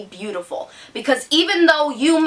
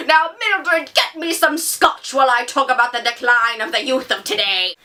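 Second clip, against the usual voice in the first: pompous adult critic; 2 semitones higher than usual. Pretend. Teen vlogger mocking adult book critics, in a pompous, snooty voice. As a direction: sneer down your nose at the youth. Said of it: "the thing that I picture when people start talking like that is this".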